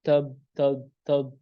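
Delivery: TAB said thick, like "tob"; wrong heavy ta. Shown as a demonstration. Teacher repeats the wrong reading, heavy "tab". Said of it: "jangan tabbat".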